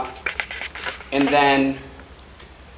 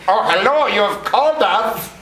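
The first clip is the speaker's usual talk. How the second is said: funny phone voice; 8 semitones higher, 4 dB louder than usual. Acted. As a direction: answer the phone as a ridiculous character. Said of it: "answer the phone in the funniest voice".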